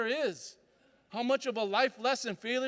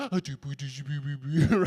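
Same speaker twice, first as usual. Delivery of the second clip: cooing babble; 8 semitones lower than usual. Retold or imitated. Parent cooing at baby.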